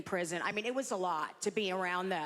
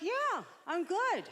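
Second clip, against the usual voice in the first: high, slow mock mom voice; botoxed mother; 8 semitones higher, slower than usual. Mother answers her worried daughter, mock botoxed mom. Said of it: "And she's like".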